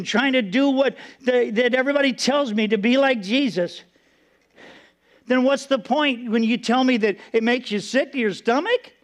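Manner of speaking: ordinary talk, nothing marked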